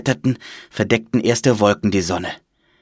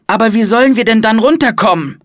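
high, loud urgent question; worried child; 12 semitones higher, 7 dB louder than usual. A narrator's reading. Child asks monk the way down, urgent question. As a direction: ask it high and pressing.

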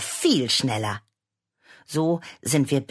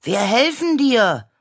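put-on high, louder child's call; called out eagerly; 9 semitones higher, 6 dB louder than usual. Boy calling to his mother that they will help, eager high call. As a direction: call it out to back up your sister. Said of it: "rief auch".

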